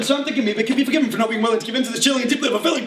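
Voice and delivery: very fast tongue-twister verse; rapid-fire recital. Acted. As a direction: race through it without a break. Rattles through the repeated verse.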